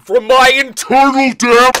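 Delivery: the voice suddenly erupts into a loud, high demonic roar; demonic outburst. Acted. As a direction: let the demon burst out mid-word. Caller's demon breaks through.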